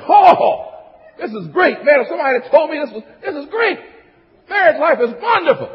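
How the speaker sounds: high, loud, overjoyed exclaiming; hammed-up honeymoon rapture; gushing newlywed. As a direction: play a newlywed bursting with delight.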